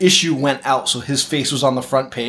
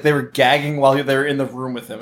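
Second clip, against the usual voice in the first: normal talking voice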